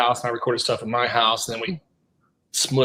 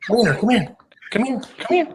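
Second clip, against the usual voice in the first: rising, coaxing call to the dog; 9 semitones higher, faster than usual. Real, not acted. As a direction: call the puppy over, higher each time. Calling the dog over.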